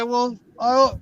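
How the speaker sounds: ordinary talk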